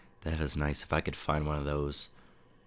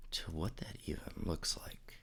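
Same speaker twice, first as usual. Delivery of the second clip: whispering; 10 dB quieter than usual. Real, not acted. Trailing off while searching.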